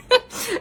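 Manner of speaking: high burst of laughter with a catch of tears; short giddy laugh; teary laugh. Real, not acted. Vlogger admits she ignored the comments, high teary laugh.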